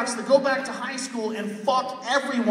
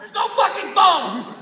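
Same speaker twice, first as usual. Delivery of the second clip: loud, higher, slow rant; ranting shout; 10 semitones higher, 9 dB louder, slower than usual. Real, not acted. Comic rants to the crowd, shouting and slow. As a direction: hammer the punchline.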